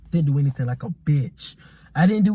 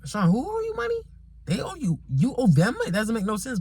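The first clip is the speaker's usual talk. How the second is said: confused dad; 7 semitones higher than usual. Retold or imitated. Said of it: "my dad was like".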